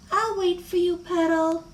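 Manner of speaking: put-on high child-character voice; small high voice talking to a doll; acted little-girl voice.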